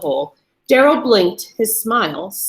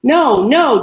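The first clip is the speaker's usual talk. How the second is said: louder, higher desperate refusal; 4 semitones higher, 7 dB louder than usual. A narrator's reading. Captive yanking his chain, desperate. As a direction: fight it, frantic.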